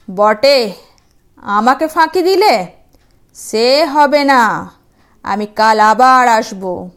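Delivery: furious demon, loud and slow; angry demon. Acted. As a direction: bellow it out as a cheated demon. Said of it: "ভারী চোটে বলল". (রাগী দানবের জোরালো গলা)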